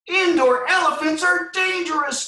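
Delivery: very high loud squawk; squawking chicken. Acted. Reading aloud, the smallest chicken squawks in alarm. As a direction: squawk the warning. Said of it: "squawked the smallest chicken".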